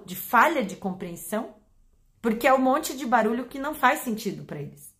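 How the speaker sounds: ordinary talk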